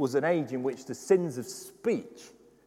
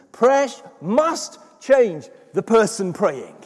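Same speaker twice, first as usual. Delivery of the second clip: louder, higher, driving sermon voice; preaching at full force; 9 semitones higher, 9 dB louder than usual. Acted. Pastor urging the congregation on prayer, full force preaching. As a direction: preach it hard to the back row.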